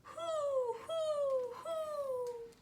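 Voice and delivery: high hooting owl call; long, high, hollow owl hoots. Acted. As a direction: hoot like a great horned owl. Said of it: "Again, he called out".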